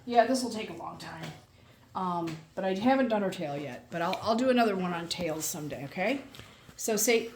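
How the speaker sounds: ordinary talk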